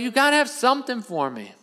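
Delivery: high, desperate appeal; acted, whiny distress; tearful pleading. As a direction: act out a son begging for any scrap. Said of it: "they're both crying".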